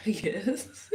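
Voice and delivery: a real laugh through the word; sheepish laughing yes. Real, not acted. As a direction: answer yes while laughing. Laughing reply to stranger's question.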